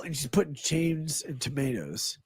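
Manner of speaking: normal talking voice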